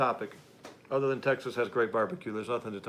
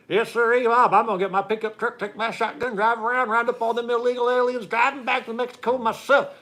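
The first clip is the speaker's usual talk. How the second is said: much higher and louder mock Southern announcer; Brian Kemp as nascar announcer; 11 semitones higher, 8 dB louder than usual. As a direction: ham up a Southern nascar announcer selling hard. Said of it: "sounds like a nascar announcer".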